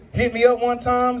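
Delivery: ordinary talk, nothing marked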